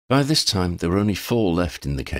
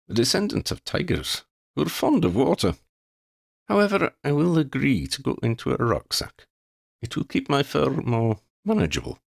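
lightly voiced proud cat, higher; a dignified feline explaining himself; a hint of a pompous talking cat; haughty cat; 7 semitones higher than usual. Xander the cat speaks.

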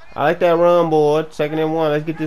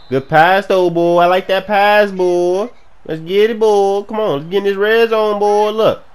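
loud, raised, celebratory; excited cheering; 5 semitones higher, 6 dB louder than usual. Real, not acted. Gamer praising a good pass, excited cheering. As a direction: hype up the drive.

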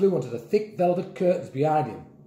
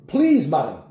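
louder, insistent plea; firm order; 6 dB louder than usual. A narrator's reading. Grandpa at the toilet door, firmly ordering. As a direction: order her politely but firmly. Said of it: "ordered Grandpa".

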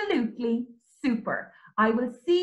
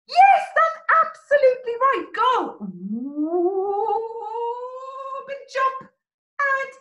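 excited praise; 8 semitones higher, 4 dB louder than usual. Acted. Bear jumps into the bucket.

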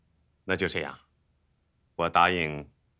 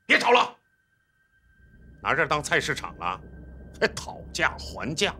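high-pitched, exasperated scolding; raised-pitch officer's rebuke; irritated reprimand; 8 semitones higher than usual. Acted. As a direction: cut the squabble short angrily. Officer breaks up the quarrel.

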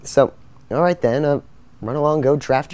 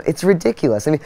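ordinary talk

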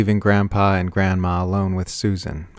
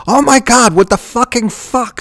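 very high, loud shriek; piercing outburst of horror; horrified shriek; 8 dB louder than usual. A narrator's reading. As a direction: shriek it in utter shock. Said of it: "Susan shrieked".

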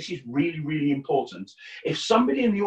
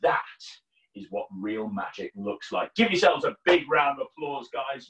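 normal talking voice

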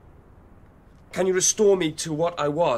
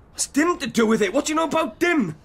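raised pitch, quickening demand; startled challenge; 9 semitones higher, faster than usual. Acted. Youth startled that his host knows a name, high urgent challenge. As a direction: fire the questions back, climbing higher.

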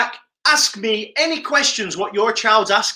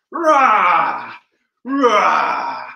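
tiger roar; 2 semitones lower than usual. Pretend. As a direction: roar out like a tiger while prowling. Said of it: "move around like a tiger".

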